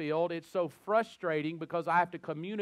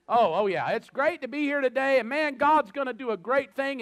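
much higher and louder, pumped revival opener; hyped-up preacher; 8 semitones higher, 7 dB louder than usual. Retold or imitated. Preacher restarting his greeting to the congregation, hammed-up excitement. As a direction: fake the fired-up restart after losing the thread.

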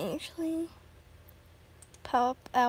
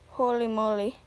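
usual speaking voice

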